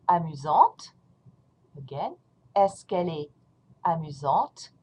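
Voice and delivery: ordinary talk, nothing marked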